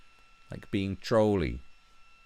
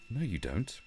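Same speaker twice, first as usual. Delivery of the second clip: ordinary talk, nothing marked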